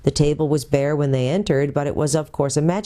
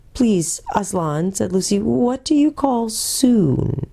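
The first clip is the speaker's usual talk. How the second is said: put-on slow, higher girlish plea; Lucy pleading; 7 semitones higher, slower than usual. Lucy begs Aslan to say when, slow wistful girl. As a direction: stretch out the wistful question.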